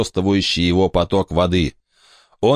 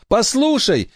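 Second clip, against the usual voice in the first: put-on very high pleading boy. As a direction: ask it as a young boy. Brandon pleads.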